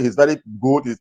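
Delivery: usual speaking voice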